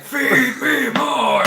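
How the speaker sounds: slow, high, loud beast demand; monster voice. Acted. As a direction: bellow it stretched out like a monster. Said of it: "I would be the worst person to put in the wrestling storyline".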